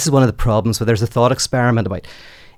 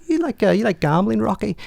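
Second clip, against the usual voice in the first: much higher, sly sing-song question; Pip teasing; 10 semitones higher than usual. Retold or imitated. Pip slyly tempting Rocky, voiced high and knowing. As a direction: coax him knowingly, like you hold a secret. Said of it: "He says".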